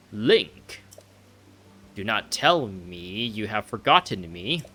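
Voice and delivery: slow, grand royal pronouncements; a ponderous king addressing a visitor; King Dorephan. Acted. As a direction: draw each phrase out regally.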